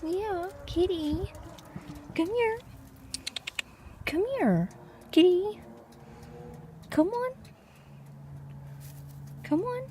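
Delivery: very high, soft coaxing call; cat-calling voice. Real, not acted. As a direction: sweet-talk the kitty in a high sing-song.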